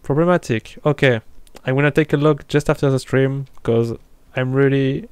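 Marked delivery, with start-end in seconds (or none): none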